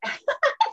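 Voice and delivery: high, loud delighted laugh; burst of laughter. Real, not acted. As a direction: break out laughing at the question. Laughs at the host's cheeky question.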